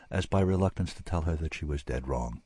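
usual speaking voice

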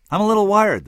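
echoing the bear's words back; echo delay effect. Acted. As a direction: throw the line back like an echo. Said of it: "like an echo delay effect".

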